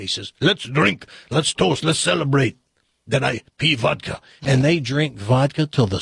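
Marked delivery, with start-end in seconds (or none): 0.4-4.2 celebratory mock Russian accent; hammed-up Russian joke accent; mixed-up Russian accent. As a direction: propose a toast in comic Russian. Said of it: "this Russian accent's gonna sound like".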